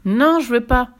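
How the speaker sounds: loud stubborn no; boy refusing the witch; refusing child. Acted. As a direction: refuse outright.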